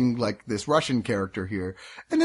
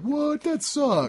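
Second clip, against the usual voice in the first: mock disappointed shock; 14 semitones higher, much slower than usual. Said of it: "and were like".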